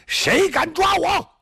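high, slow drawn-out yell of defiance; big challenge shout. Acted. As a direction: bellow it slowly to the whole army. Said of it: "你大喊三声".

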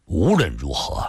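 low, resigned man's voice; sigh, then give in at a low pitch; long weary sigh. A narrator's reading. Li relenting to let him go, resigned sigh. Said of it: "李寻欢突然长长叹了口气".

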